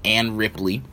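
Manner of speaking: ordinary talk, nothing marked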